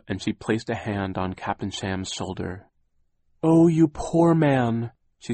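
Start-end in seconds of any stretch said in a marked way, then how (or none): 3.4-4.9 sympathetic. A narrator's reading. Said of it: "eyes welled up".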